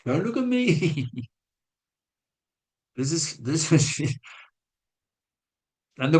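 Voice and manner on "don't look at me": higher, half-joking don't-ask-me; mock plea. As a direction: playfully wave off the students' looks. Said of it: "they looked at me and I was".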